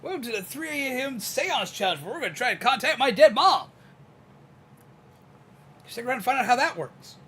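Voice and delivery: high, loud hyped streamer intro; clickbait video narrator. Pretend. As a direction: pitch it like a clickbait challenge video. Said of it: "use the streamer voice".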